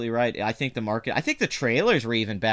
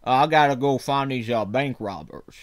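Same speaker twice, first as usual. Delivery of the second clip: Jeff Bridges; 4 dB louder than usual. Acted. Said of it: "Jeff Bridges in that movie".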